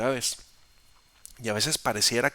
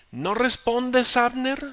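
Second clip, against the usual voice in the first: high, slow shout across a distance; calling out; 11 semitones higher, 6 dB louder, slower than usual. Acted. David shouting across the valley to Abner, loud and high. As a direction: bellow the question across the valley.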